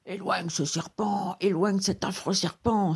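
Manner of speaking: drawn-out plea full of dread; terrified cry. A narrator's reading. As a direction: plead in a frightened put-on voice. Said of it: "s'écria-t-il avec effroi".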